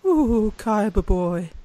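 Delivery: slow, sultry villain purr; Pegasus impression. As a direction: drawl it out like a seductive villain. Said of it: "I think I can do Pegasus".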